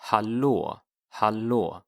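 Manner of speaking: hallå said slowly as "hah-lo", stress on the first syllable; teacher repeats hallå for learners, slow and careful; Swedish hallå. Shown as a demonstration. Teacher drills hallå for students, slowly. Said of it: "or hallå".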